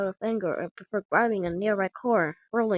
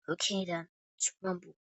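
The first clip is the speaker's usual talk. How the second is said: quiet challenge; 3 semitones lower, 9 dB quieter than usual. Acted. Fighter readies for the fight.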